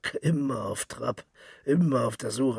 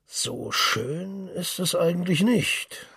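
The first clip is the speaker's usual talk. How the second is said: slow, high, drawn-out musing; slow savouring; 6 semitones higher, slower than usual. Acted. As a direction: savour each word like the whisky. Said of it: "verweilte bei dem Wort".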